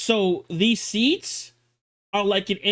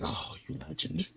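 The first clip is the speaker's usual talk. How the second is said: awed hush; 5 semitones lower, 13 dB quieter than usual. Retold or imitated. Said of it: "I'm like".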